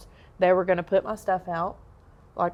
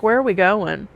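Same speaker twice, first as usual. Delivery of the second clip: louder, alarmed question; panicked in the jail cell; 3 semitones higher, 9 dB louder than usual. Retold or imitated. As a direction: blurt it out in panic. Retold panic on being called.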